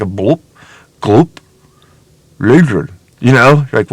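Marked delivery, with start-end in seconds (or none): 0.1-2.9 spaced-out gloopy water noises; imitated water sounds. Man voicing the stream speaking to him, burbling imitation. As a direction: mimic a burbling stream, low blurts. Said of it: "you'll hear, like".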